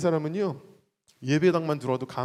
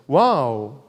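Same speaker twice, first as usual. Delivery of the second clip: louder, drawn-out wow; wow, leading the crowd; 4 semitones lower, 6 dB louder, much slower than usual. Shown as a demonstration. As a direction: call out wow for the crowd to repeat. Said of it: "한번 따라할까요?".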